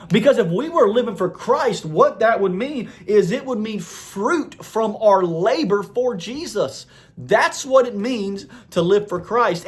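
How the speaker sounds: high, forceful pulpit delivery, key words stretched; impassioned preaching. Real, not acted. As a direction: preach it hard, pitch lifted well up.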